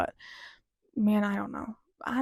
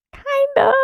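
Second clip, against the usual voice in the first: very high, loud squeak; squeaky high-pitched hedge; comic hedge; 7 dB louder than usual. Real, not acted. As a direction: squeal it out as a sheepish admission. Comic answer to own question.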